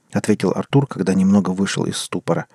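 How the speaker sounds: usual speaking voice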